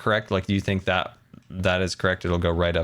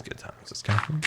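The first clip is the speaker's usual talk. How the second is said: softer, fading sign-on; trailing-off intro patter; old show intro; 2 semitones higher, 7 dB quieter than usual. Shown as a demonstration. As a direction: let the catchphrase drop away softly. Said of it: "that was my old intro".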